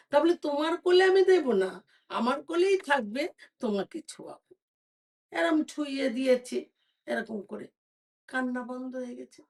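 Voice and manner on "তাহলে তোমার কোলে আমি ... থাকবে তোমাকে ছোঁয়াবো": high, loud, quick insistence; firm queen. Acted. Queen tells the beggar she will only let him touch the baby, high firm voice. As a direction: lay down the terms.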